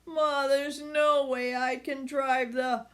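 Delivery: sick son.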